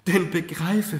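high, loud, urgent appeal; impassioned confession. A narrator's reading. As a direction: implore her to understand. Mother confesses to daughter in remorse.

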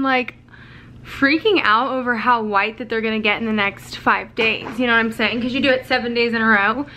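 ordinary delivery

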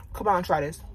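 talking mid-bite; chewing while speaking; mouth full of pizza. Real, not acted. Seen 1: Pizza held in teeth 0.1–2.4, then chewing with full mouth.

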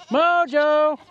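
high, loud call to the dog. Real, not acted. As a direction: call him from across the yard. Calling the dog.

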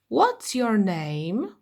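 very slow, clear English for the class; teacher modelling a question; slow model phrase. Shown as a demonstration. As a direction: spell it out word by word for beginners.

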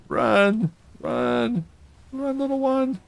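high frantic urging; being chased. Real, not acted. Fleeing attackers in boat.

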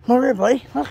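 loud, high call to dog. Real, not acted. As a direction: call him from afar. Calling dog over.

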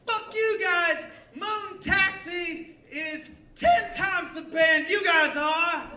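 high, slow mock heckler shout; heckler yell. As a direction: yell like an angry drunk heckler. Heckler yelling at band.

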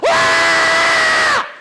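put-on high, loud kid's shriek; kids screaming. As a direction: let out a kid's frantic shriek. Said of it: "They're like".

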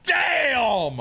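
high, drawn-out yells; frustrated shouting. Real, not acted. Streamer venting at a bad play, high frustrated shout. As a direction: yell each phrase long and high. Frustrated outburst over the game.

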